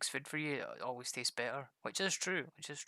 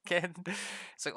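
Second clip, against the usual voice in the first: usual speaking voice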